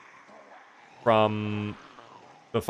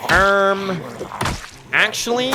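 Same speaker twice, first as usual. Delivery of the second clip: pushes up imaginary glasses; nerdy correction; 9 semitones higher, much slower than usual. Pretend. Streamer to chat, mock nerd voice.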